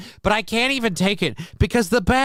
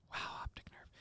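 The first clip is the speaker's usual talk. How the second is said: breathy whisper to himself; voiceless aside to himself; 26 dB quieter than usual. Real, not acted. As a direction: whisper it, close to the camera. Muttering while checking skin.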